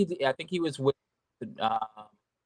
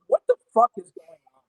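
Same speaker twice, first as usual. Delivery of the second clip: higher, louder baffled outburst; retold bewilderment; 7 semitones higher, 10 dB louder than usual. Caller retelling his confusion on the phone, baffled outburst. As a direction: act out loud, exasperated confusion.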